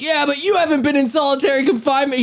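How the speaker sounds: high, whiny defensive voice; high whiny parody of the Shaman; mocking him. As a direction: whine your excuse in a high voice. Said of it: "He's like".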